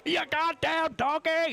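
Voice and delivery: high, slow mock chef yell; mock Gordon Ramsay kitchen rant. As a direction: bellow like an angry TV chef insulting a cook. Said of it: "the Gordon Ramsay in the back".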